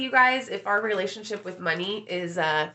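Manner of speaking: ordinary delivery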